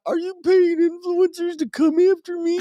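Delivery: very high-pitched whiny impersonation; mock whining. Host mocking a politician worried about influencers, high whine. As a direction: whine it in a squeaky put-on voice. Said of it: "Stop whining".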